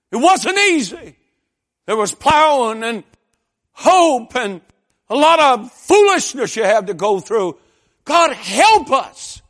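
high, loud shouted preaching with stretched words; full-force preaching. Acted. Telling the congregation of the long fight to save him, full-force preaching.

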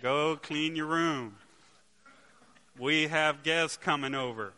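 slow, loud parental command; loud quoted order. Retold or imitated. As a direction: bellow the chore order like a fed-up dad. Son quoting dad's order.

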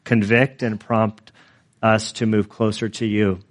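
hushed, unhurried closing prayer. Acted. Pastor praying over the church, hushed and reverent. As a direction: pray it softly before God.

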